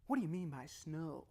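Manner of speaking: slow, drawn-out question; gently probe, lingering on the last word; curious questioning. Acted. Modelling a question to understand someone, slow and curious. Example question, slowed and softened.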